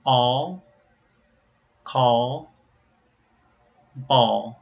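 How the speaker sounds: All, call, ball with dark L; dark hollow l. Shown as a demonstration. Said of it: "so you can hear".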